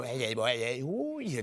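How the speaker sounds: hint of a hammed-up celebrity voice; mock impression. Comic greeting Adam Sandler from the stage, mock impression. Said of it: "I'll do an impression".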